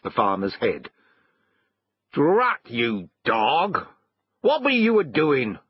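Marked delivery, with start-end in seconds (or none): 2.1-5.6 irritated farmer, rising higher at the question; farmer in a rage. A narrator's reading. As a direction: scold the dog from the window. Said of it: "in the midst of his rage".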